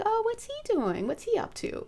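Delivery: high, light curious wondering, trailing to a near whisper. Retold or imitated. Seeing her person in a dream, curious high wonder. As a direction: wonder aloud what he's up to. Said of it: "And I was like".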